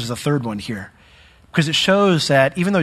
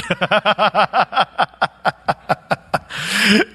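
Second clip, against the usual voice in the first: long rattling laugh, slowing down; 4 semitones higher than usual. Real, not acted. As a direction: laugh it out in stuttering bursts. Laughs while telling the story.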